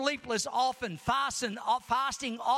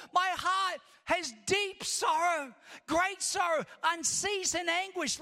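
higher, shouted preaching; full-force preaching; 6 semitones higher than usual. Acted. Preacher crying out Paul's sorrow to the congregation, shouting. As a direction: proclaim it like a cry of anguish.

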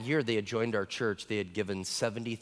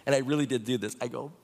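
normal talking voice

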